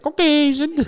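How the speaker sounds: Caucasian said high with a rising finish; mock-posh Caucasian. Shown as a demonstration. Trying out a new way, high rising Caucasian. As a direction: lift Caucasian up fancy. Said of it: "I might start saying it like that".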